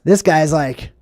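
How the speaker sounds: normal talking voice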